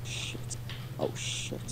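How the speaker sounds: hushed whispered curses while hiding; whispering. Real, not acted. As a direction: whisper so the monster won't hear.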